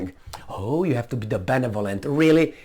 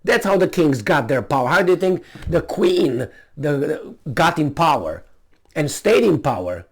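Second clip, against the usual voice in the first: normal talking voice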